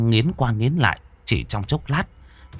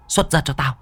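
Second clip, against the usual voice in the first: commanding shout; 11 semitones higher than usual. A narrator's reading. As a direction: order the demon out, higher the second time. Exorcist commands demon out.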